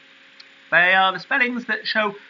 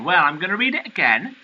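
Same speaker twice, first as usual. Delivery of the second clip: ordinary talk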